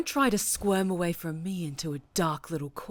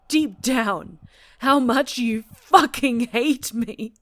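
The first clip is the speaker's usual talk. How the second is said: builds to a loud, higher, desperate cry; anguished outburst; 3 semitones higher, 8 dB louder than usual. Acted. Captor confessing despair to prisoner, building to a loud cry. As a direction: let the hurt break into a shout.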